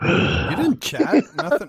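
surprised. Real, not acted. Disbelief at missed chat.

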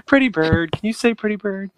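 high sing-song teaching a parrot. Retold or imitated. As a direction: coax a bird to talk, high pitched. Quoting the parrot scene.

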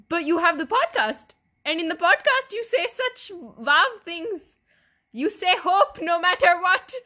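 high, loud, insistent imitation; mock incredulous parents. Parents reacting to her depression, mock incredulous protest.

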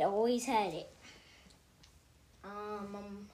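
usual speaking voice

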